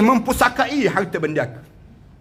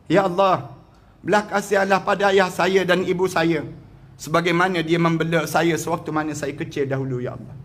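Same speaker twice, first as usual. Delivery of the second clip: lower, humble pleading prayer; humble prayer for parents; 5 semitones lower than usual. Acted.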